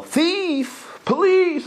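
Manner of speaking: high, loud mock cry of Thief and police; mock yell for help. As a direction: yell it high like a panicked victim. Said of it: "He doesn't yell".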